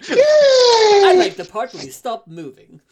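very high, slow, loud puppet voice; squeaky cartoon puppet, drawn out; Harry Potter Puppet Pals voice. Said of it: "in the Harry Potter Puppet Pals voices".

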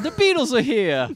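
high, loud, hammed-up British; theatrical mock-British announcement; mock British accent. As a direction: put on a silly British accent. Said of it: "doing British accents".

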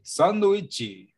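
stretched, imitated Brazilian "sanduíche"; Brazilian-style pronunciation. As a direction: draw out "sanduíche" slowly, as Brazilians say it. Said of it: "All the Brazilians say".

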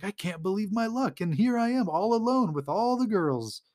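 high, gloating mock delight; playfully retold giddy glee; excited self-talk. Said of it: "he's thinking to himself".

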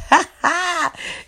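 high burst of laughter. Real, not acted. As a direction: burst out laughing after the joke. Laughs at own whispering bit.

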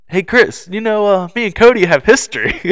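loud, high-pitched impression of a friend; hammed-up retold announcement; imitated radio announcement. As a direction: broadcast it gleefully over the channel. Imitating Darren broadcasting on radio.

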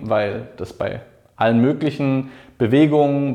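ordinary talk, nothing marked

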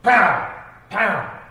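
loud, high pow sound effects; imitated slaps. Shown as a demonstration. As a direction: smack out each pow. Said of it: "hit him with the palm".

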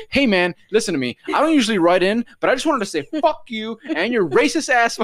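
high, loud mock tirade; hammed-up indignant complainer, building in pitch; angry email writer. Offended listener writing in to the podcast, mock angry. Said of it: "we get emails".

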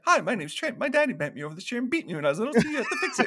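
high, rushed mocking voice; hammed-up whiny parody of an angry friend; mocking imitation of Trent. [name] mimics Trent to himself on the way to the shed, mocking.

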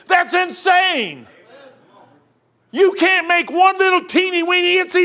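loud, driving street preacher; preaching at full force. Acted. Preacher shouting his case to the listeners, loud and driving. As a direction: hammer it home to the crowd. Preaching about hell and creation.

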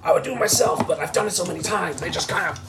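mock Rick lecturing Morty; lightly voiced Rick. Continues Rick impression, laughing.

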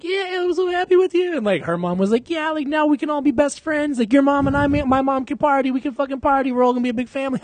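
high, airy mocking mom impression; gushing mom voice. Son mocking the moms' excitement about becoming friends. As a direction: play an overexcited mom wanting to party.

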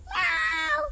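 miau agudo y agudísimo de gatito; maúlla como un gatito chiquito; maullido de gatito. Acted. (maullido agudo de gatito)

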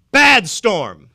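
loud, playful scolding; mock rebuke. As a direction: scold the storm like a naughty pet. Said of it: "he got up and he rebuked it".

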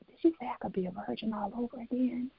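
hushed and quick, imitating the girl's whisper; girl whispering a question in her ear; retold whisper. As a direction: lean in and breathe it like a secret. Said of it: "whispered in my ear".

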